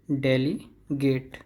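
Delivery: Delegate split as "deli... gate"; slow syllable demo. Shown as a demonstration. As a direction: draw out delegate with a gap before "gate". Teacher breaks the word up slowly.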